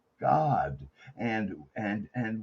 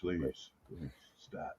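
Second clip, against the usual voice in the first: whispered; 5 semitones lower, 11 dB quieter than usual. Real, not acted. Joking plea for more DMT.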